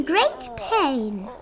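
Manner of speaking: high, pained pleading voice; groaning Mac. A narrator's reading. Mac groaning up to the king from the bottom of the stack. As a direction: plead from under the crushing weight. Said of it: "groaned Mac".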